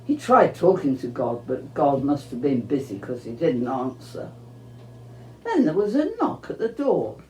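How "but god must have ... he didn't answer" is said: usual speaking voice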